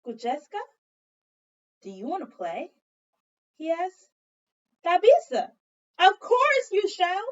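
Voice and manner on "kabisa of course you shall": eager yes. Acted. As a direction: burst out with excitement. Eager answer in story.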